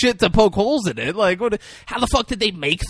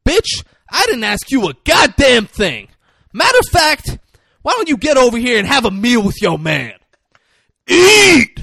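yelling quote, high and loud; furious shout; 6 semitones higher, 7 dB louder than usual. Retold or imitated. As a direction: bellow it at her like Samuel Jackson. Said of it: "but he says".

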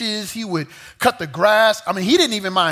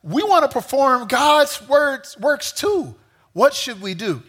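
eager crowd; 7 semitones higher than usual. Retold or imitated. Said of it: "And they replied".

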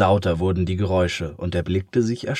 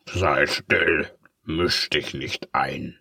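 low slow snapping villain; mild villain snarl; 4 semitones lower, slower than usual. Acted. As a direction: shut the glass up. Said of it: "geiferte der Tintenkiller".

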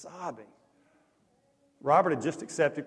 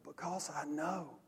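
slow, soft, barely audible confession; under his breath; 10 dB quieter than usual. Retold or imitated. Camper confessing to counselors, under his breath. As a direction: let it out quietly and slowly. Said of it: "Under his breath, just barely audible".

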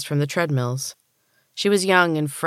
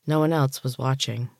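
ordinary delivery, nothing marked

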